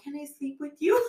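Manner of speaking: put-on high little-kid request; imitated pleading daughter. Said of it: "she's like".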